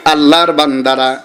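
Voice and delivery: ordinary talk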